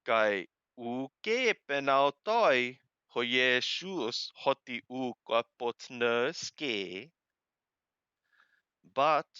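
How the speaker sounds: ordinary delivery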